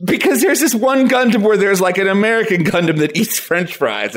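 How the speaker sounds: ordinary talk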